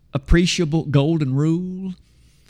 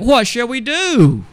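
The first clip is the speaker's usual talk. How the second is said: slow, loud and higher, a desperate plea; crying out; 8 semitones higher, 6 dB louder, slower than usual. Retold or imitated. Crowd crying out to Peter, slow and loud pleading. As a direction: cry it out like a crowd asking for help. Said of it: "they cried out".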